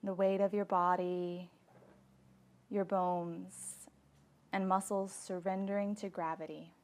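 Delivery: calm Yoga Nidra leading, phrase by phrase; guided meditation. Acted. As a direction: guide them gently into deep relaxation. Leads guided relaxation practice.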